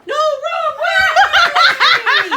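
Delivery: slow, loud laughing yell; laughing shout. Real, not acted. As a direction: shout at the bird while laughing. Bird flies wrong way.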